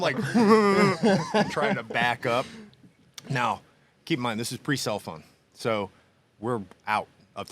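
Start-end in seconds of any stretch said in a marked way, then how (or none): 0.2-1.5 loud, high, open laugh; burst of laughter. Real, not acted. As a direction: crack up at the memory. Laughing at his mangled bike.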